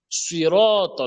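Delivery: SUIROT with a heavy so sound and rounded o, drawn out; correct emphatic S. Shown as a demonstration. Teacher correcting sirata, slow heavy SUIROT. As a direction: say SUIROT slowly, darkening s and both vowels. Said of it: "ça veut le so".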